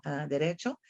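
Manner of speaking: normal talking voice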